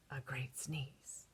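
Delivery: slow hushed whisper. Acted. Wolf telling children about the sneeze, whispered slowly. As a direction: hush it like a secret.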